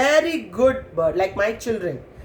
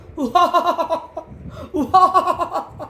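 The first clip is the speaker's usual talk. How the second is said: high cackle in two rattling bursts; mock Baby Roo with a bird's laugh; kookaburra laughing; 9 semitones higher than usual. A narrator's reading. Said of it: "I'm laughing at you".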